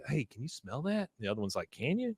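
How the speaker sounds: hushed wonder. Retold or imitated. Cult members quietly asking about the smell, hushed. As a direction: whisper it to each other.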